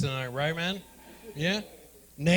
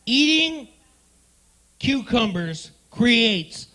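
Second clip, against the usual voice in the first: mock article reading; 3 semitones higher, 6 dB louder, slower than usual. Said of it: "it said".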